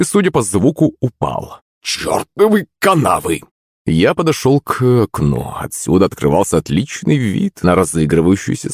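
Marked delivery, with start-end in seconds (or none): 1.8-3.4 put-on high, slow drunken curse; drunk cursing. A narrator's reading. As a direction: curse like a drunk who just fell. Drunk cursing after a fall. (пьяная ругань)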